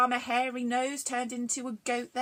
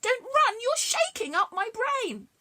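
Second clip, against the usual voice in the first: high, loud protest; head yelling at the running miller; complaining head; 13 semitones higher, 5 dB louder than usual. A narrator's reading. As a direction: wail it up high. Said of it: "the head complained".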